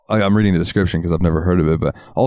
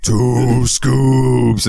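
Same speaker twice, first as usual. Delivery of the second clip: drawn-out, higher cartoon sun voice; imitated mascot announcement; booming catchphrase; 4 semitones higher, 3 dB louder than usual. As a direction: stretch both words out like a giant mascot.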